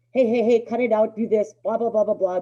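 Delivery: loud, high, hurried nagging; mock a panicked owner yapping at the dog; frantic babbling owner. Owner babbling at a hyperactive dog, loud frantic nagging. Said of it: "They're babbling, they're talking fast".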